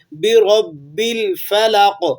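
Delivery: بِرَبِّ with a hard pause on the ب; doubled letter. Shown as a demonstration. Teacher shows the tashdeed to students. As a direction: hold the shadda in بِرَبِّ firmly. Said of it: "رک کر پڑھ رہے ہیں".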